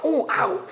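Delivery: high, hammed-up ow cries; mock yelps of pain. Mocking a contestant bitten, high yelping ows. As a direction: yelp like something's biting you. Mocking woman attacked in swamp.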